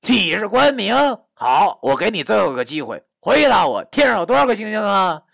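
loud, high interrogation; aggressive, shouted questioning; angry scolding. Acted. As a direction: challenge them at full voice. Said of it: "对他骂道".

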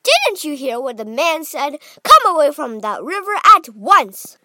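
loud, high scolding; mother ordering her son back; calling out. A narrator's reading. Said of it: "called out Missus Gloop".

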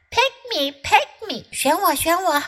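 high, chirpy little bird; eager bird voice. Acted. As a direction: beg brightly like a small bird. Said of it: "Bird waved her wing".

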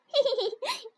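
sly piggy giggle. A narrator's reading. Piglet giggles at the frog to 蹦蹦. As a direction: snicker mockingly. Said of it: "却咯咯地笑着".

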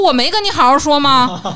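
loud, high indignant comeback; re-enacted quarrel outburst; retold angry retort. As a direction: throw the question back loudly.

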